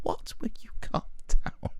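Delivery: whispered incredulous aside. Real, not acted. Aside to listeners after the yelling, whispered. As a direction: whisper it in disbelief.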